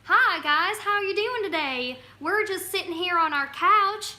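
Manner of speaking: playful southern western twang; southern western accent. Pretend. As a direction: put on a twangy cowboy-hat southern accent. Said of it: "talk in, like, a southern, western type accent".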